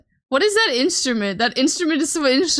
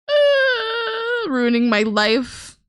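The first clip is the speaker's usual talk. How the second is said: mock wail; 12 semitones higher, much slower than usual. Mock despair at being yelled at.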